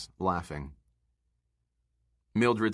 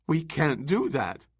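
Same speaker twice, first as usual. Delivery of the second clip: mild wail; 7 semitones higher than usual. A narrator's reading. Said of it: "wailed Missus Bowles".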